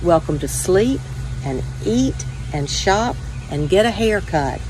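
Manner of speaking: plain reading voice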